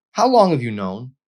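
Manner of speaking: high, loud angry demand. Acted. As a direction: confront him, furious. Girl confronts boy after slap.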